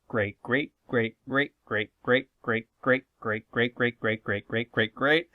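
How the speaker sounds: mock-endless family tree; rattled-off repetition. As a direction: rattle off "great, great" like an endless chain. Comic run-on list of greats.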